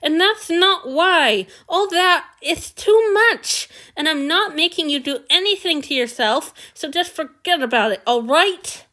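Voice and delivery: lisping, frustrated pleading. A narrator's reading. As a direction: plead through a lisp. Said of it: "Frustration and pleading in a persistent lisp".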